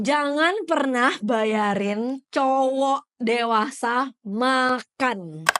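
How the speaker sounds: very slow, word by word; emphatic announcement. Real, not acted. Host announcing rule three to listeners, slow and emphatic. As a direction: proclaim the rule, stretching each word.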